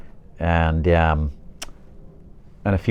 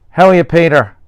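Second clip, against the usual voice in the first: loud, high call across the street; shouted greeting; 11 semitones higher, 9 dB louder than usual. Acted. Old classmate hailing Peter across the street, shouted greeting. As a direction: call out to a friend at a distance. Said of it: "shouted across the street".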